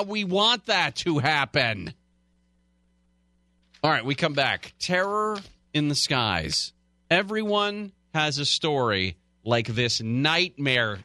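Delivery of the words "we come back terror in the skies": ordinary talk, nothing marked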